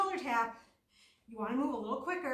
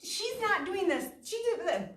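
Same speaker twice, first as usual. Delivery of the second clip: mock judging; 7 semitones higher than usual. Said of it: "And I was like".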